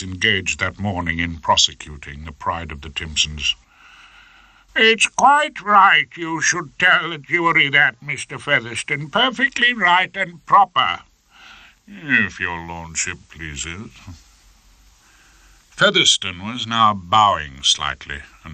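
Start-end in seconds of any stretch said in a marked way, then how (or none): 4.8-11.0 high, slow, lordly judge voice; a pompous high-court judge, much higher; fussy old judge. Acted. 11.9-13.9 soft, slow, deferential; lightly voiced fawning barrister. Featherstone to judge.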